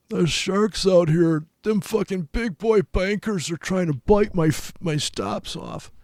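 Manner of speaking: high, slow, put-on whining voice; mock paranoid trader. Trader mocking a paranoid small trader, high and slow whine. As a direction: whine it like a scared little trader about sharks.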